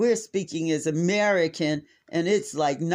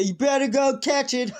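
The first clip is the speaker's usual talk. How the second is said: prank punchline; 9 semitones higher, 4 dB louder than usual. Retold or imitated.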